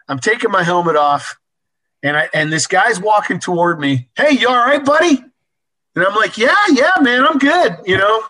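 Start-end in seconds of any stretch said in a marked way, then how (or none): 4.2-5.2 calling out. Retold or imitated. Trucker calling while walking over. 6.4-7.8 reassuring call. Retold or imitated. Reassuring trucker after crash.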